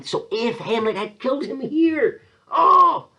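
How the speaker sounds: high, rising to a loud "oh"; worked-up exclamation. Real, not acted. Teacher lamenting if Hamlet had killed him here, worked-up exclamation. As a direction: exclaim over a huge missed chance.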